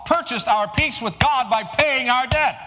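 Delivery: high, loud preaching at full force. Acted. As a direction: proclaim it to the whole congregation.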